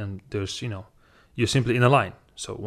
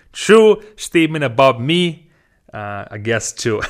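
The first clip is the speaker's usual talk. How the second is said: laughing; 3 semitones higher, 6 dB louder than usual. Real, not acted. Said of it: "laughs while saying it".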